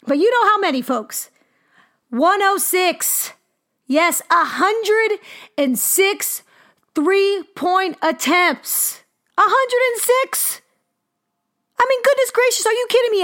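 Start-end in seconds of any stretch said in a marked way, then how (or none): 2.1-9.0 every word drawn out slowly; slow emphasis. Real, not acted. Stating a record number. 9.4-10.6 much higher, stunned question on hundred; disbelief. Real, not acted. Incredulous repeat of the number. 11.8-13.2 much higher, astonished exclamation; stunned, almost laughing in disbelief; amazed. Real, not acted. Amazed at the record.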